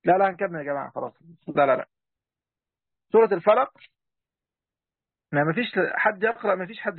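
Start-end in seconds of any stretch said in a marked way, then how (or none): 3.1-3.7 announced louder to the group; calling out the sura. Real, not acted. Teacher announcing the sura to students, louder. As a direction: call the sura name out to the class.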